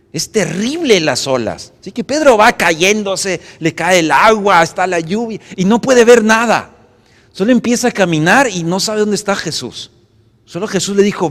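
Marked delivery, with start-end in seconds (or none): 1.8-11.3 dramatic preaching. Acted. Dramatic retelling of the storm.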